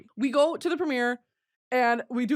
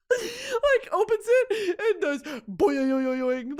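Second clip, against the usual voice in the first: higher, cracking with laughter; laughing through it; 9 semitones higher, slower than usual. Real, not acted. Describing the teenage boy image, laughing hard. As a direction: get the words out while laughing.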